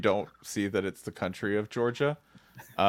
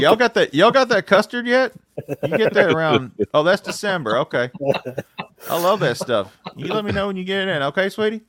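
higher, louder Southern sweet-talk; folksy Southern regular; 10 semitones higher, 9 dB louder than usual. Pretend. Uncle at the restaurant sweet-talking the waitress about custard, higher and louder.

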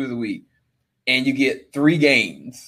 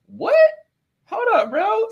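surprised disbelief. Retold or imitated. Retelling reaction to offer.

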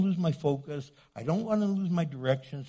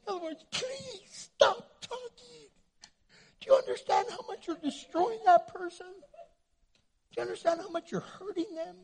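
tearful plea; 13 semitones higher than usual. Acted. Pleading against hurtful talk.

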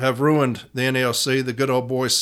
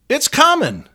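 excited; 10 semitones higher, 4 dB louder than usual. Real, not acted. Rallying speech peak.

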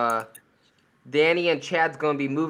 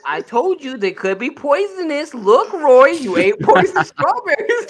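mock mom; 9 semitones higher, 5 dB louder than usual. Mocking mom's warning, teasing.

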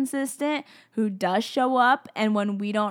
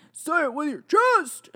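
higher, drawn-out playful holler; mock hype shout; 6 semitones higher than usual. Speaker riffing on a favorite saying, mock holler. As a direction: belt the slogan out as a joke. Joking slogan shouted in put-on voice.

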